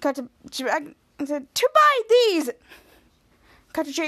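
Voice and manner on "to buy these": high, loud acted announcement; mock dramatic reveal. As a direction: play up the big reveal, pitched high. Acts out the daughter's big reveal.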